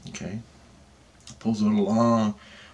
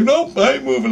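high, louder hasty assurance; retold nervous compliance, pitched way up; mock anxious promise; 5 dB louder than usual. Patient promising surgeon to stay still, nervously.

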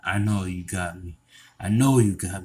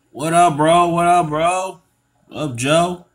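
high, loud call-out; greeting his friend, loud and high; 9 semitones higher, 9 dB louder than usual. Acted. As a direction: call out to a buddy.

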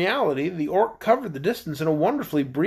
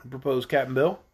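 plain reading voice

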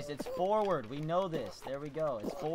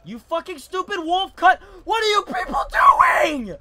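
loud, high outburst rising to a yell; angry shout; 8 semitones higher, 9 dB louder than usual. Real, not acted. Gamer yelling at other players, angry shout. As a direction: bellow at incompetent players.

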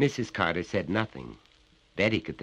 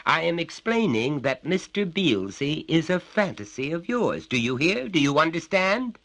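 lecturing father, raised and higher; stern father; 11 semitones higher, 6 dB louder than usual. Acted. Father lectures his little son, stern and raised. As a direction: talk down to the child from on high.

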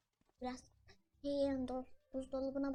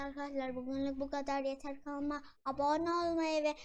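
vlog outro; 3 semitones higher, 6 dB louder than usual. Acted. Channel outro.

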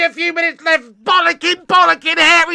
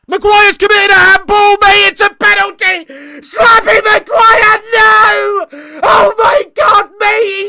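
loud, high cries of disbelief; outraged shouting; 6 semitones higher, 9 dB louder, slower than usual. Real, not acted. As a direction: yell in horror at your own defender. Penalty given against his team.

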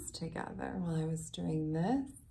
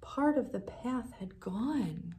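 usual speaking voice

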